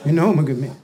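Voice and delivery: low, small-voiced and unsure; meek acted husband; meek self-assurance. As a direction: say it timidly, half believing. Husband timidly answers the reassurance.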